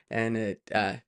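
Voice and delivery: ordinary delivery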